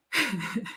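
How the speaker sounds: quiet laugh to oneself; soft laugh. Real, not acted. Storyteller amused by own rambling story, soft laugh. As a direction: let out a small, soft laugh.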